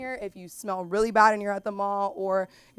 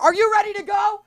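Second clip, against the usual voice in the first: put-on girlfriend calling out; 12 semitones higher, 9 dB louder than usual.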